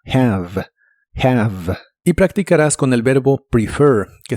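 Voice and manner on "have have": "Have" with a soft breathy h like a light Spanish j; "have" con h suave como j; soft h demo. Shown as a demonstration. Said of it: "La H se pronuncia como una J suave".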